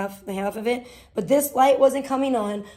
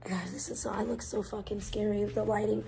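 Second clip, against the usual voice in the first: hushed whisper to the camera; whispered aside; 2 semitones lower, 10 dB quieter than usual. Real, not acted. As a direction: whisper it like a little secret. Talking quietly to camera, aside.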